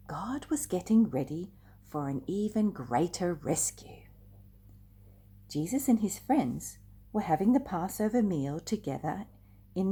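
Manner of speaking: put-on lively, animated read-aloud for kids; storytelling to children. A narrator's reading. Storyteller reading a Bible story to children, animated. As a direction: draw the little ones into the story.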